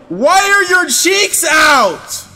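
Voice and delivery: slow, high, loud disbelief; shocked shout. Real, not acted. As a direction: yell it out stunned. Shocked at naked chaser.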